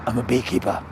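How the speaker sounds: low, soft English tough guy; English accent. Acted. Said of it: "You're butchering the English accent".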